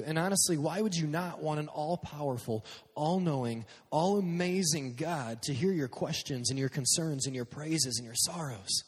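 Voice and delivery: ordinary delivery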